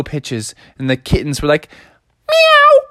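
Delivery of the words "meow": very high loud meow; kitten meow. Acted. Kitten meowing at Froggy, very high.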